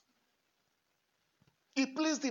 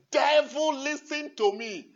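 loud call to the devil, then slower; addressing the devil; 5 semitones higher, 8 dB louder, slower than usual. Retold or imitated. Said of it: "I say".

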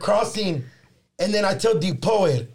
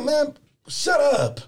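annoyed inner voice; 9 semitones higher, much slower than usual. Retold or imitated. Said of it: "in my head".

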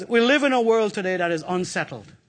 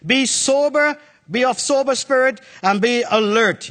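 raised, emphatic scripture reading; preaching scripture; 5 semitones higher, 5 dB louder than usual. Acted. Preacher reading to the congregation, emphatic. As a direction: proclaim it to the church.

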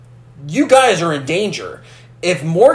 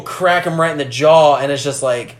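ordinary talk

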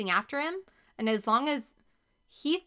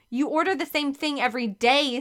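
louder, higher angry sputter; 5 semitones higher, 5 dB louder than usual. A narrator's reading. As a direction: spit it out in outrage. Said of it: "Stephanie sputtered".

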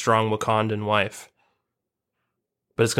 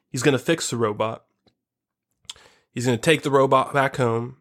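ordinary delivery

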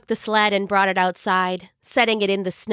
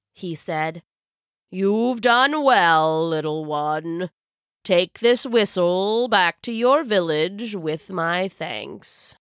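put-on kind old wizard; 3 semitones lower than usual. Wizard kindly praises Silva.

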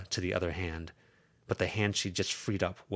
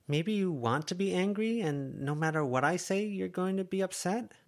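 higher, lightly voiced innocent question; 11 semitones higher than usual. Verona to Lucy, mock innocent. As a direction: play it innocent, feigning naivety. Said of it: "sounding innocent".

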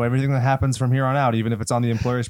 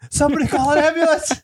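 squeaky high mock shout; high mock yell; 4 dB louder than usual. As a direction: yell it high like a panicked exec. Quoting RoboCop line mockingly.

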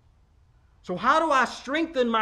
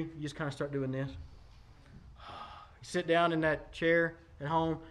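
ordinary talk

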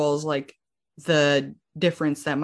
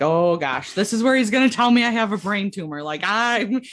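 retold panic; 4 semitones higher, 4 dB louder than usual. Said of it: "Like, freaking out".

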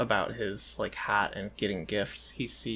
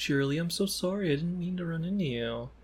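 higher-pitched mock apology; acted apology; 6 semitones higher, 4 dB quieter than usual. Spike apologizing to Cheerilee for bumping into her, apologetic.

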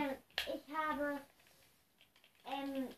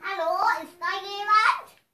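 calling out; 6 semitones higher, 16 dB louder than usual. Pretend. Calling out in empty hotel.

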